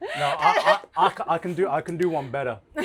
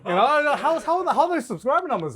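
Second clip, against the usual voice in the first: mock nagging parent; 10 semitones higher, 6 dB louder than usual. Mocking parent voice.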